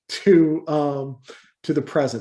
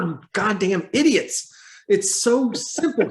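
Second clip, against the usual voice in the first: higher-pitched, raised-voice frustration; exasperated outburst; 6 semitones higher than usual. Real, not acted. Podcaster ranting at the movie's characters, exasperated. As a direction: burst out at those idiots in disbelief.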